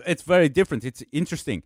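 a light imitation of a German accent; a hint of a German accent. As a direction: lightly mimic the German boss asking a question. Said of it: "he had a very heavy accent".

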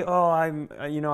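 ordinary delivery

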